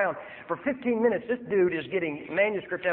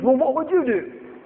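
mock challenger; 8 semitones higher, 5 dB louder, slower than usual. Retold challenge from Bible college man.

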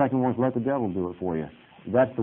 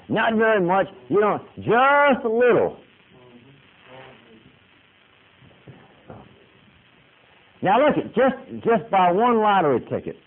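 much higher and louder, wheedling mock tempter; tempter coaxing; 8 semitones higher, 4 dB louder than usual. Preacher voicing a coworker tempting compromise, high mock coaxing. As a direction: coax it up high like a sly salesman. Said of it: "somebody come up to you and say".

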